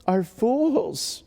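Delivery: high, slow, emphatic declamation; lamenting God. Acted. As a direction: pronounce it as a pained, ringing indictment. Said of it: "He says, he says".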